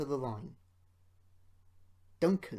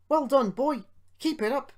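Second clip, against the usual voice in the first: much higher, louder cheer; encouraging shout; 11 semitones higher, 10 dB louder than usual. A narrator's reading. Driver cheering Duncan up the hill, loud encouragement. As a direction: call out to spur the engine on. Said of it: "encouraged his driver".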